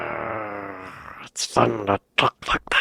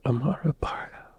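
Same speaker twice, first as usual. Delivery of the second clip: whispered; 2 semitones higher, 8 dB quieter than usual. Acted. Reacting alone to a pirate video, whispering the name.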